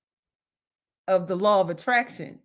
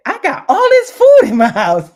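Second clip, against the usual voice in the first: loud, high, thrilled cry; excited shout; 7 semitones higher, 14 dB louder than usual. Retold or imitated. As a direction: burst out with delight at the full kitchen. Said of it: "I jumped up and said".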